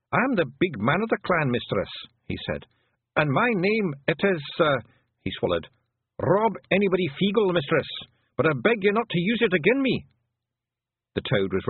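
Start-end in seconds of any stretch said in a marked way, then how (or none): 0.1-10.1 higher, hesitant little clan chief; worried. A narrator's reading. Worried Feegle chief reveals his name to hag, anxious. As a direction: give the name reluctantly, pitched up. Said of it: "looked up very worried".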